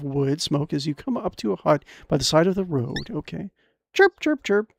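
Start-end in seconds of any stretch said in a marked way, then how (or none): 3.9-4.7 high chirping bird noises; bird chirps. Acted. Player voicing the little bird, high chirps.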